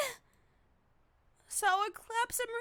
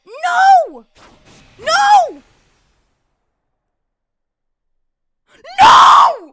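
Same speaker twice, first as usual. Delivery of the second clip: real screams; 8 semitones higher, 21 dB louder, much slower than usual. Real, not acted. Reacting to a shocking game scene.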